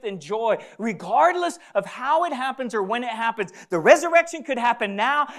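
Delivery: loud, high, driving sermon voice; full-force preaching. Acted. Preacher declaring resurrection right now to the congregation, full-force preaching. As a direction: proclaim it at full volume, pitched high.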